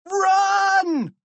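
very high, loud scream to flee; high-pitched panicked yell; cried out. A narrator's reading. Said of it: "cried George".

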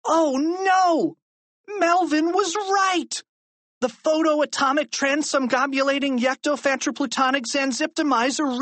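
high-pitched, alarmed outburst; high, frantic boy's cry; panicked cry. A narrator's reading. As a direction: wail the technobabble in rising panic. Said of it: "Harold cried convolutedly".

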